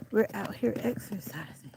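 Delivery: breathy whispered repeat of the chant. Real, not acted. Vlogger repeating her walking chant, whispered. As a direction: drop to a whisper.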